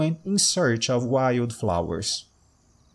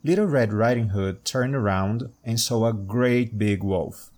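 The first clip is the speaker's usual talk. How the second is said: ordinary delivery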